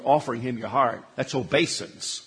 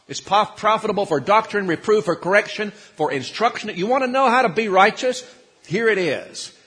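rising, louder, higher preacher's cry; preaching at full force; 6 semitones higher, 5 dB louder than usual. Acted. Preacher urging the congregation toward righteousness, fervent and loud. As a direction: build the sermon to a ringing peak.

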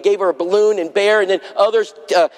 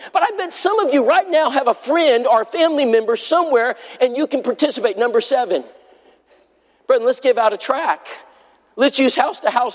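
preaching; 4 semitones higher than usual. Acted. Preacher exhorting his congregation.

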